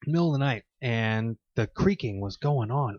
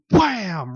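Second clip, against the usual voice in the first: bang it out louder, like a fist on the ceiling; loud wham; 7 semitones higher, 4 dB louder than usual. Retold or imitated. Retelling the ceiling punch to friends, a loud mock bang. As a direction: slam the word.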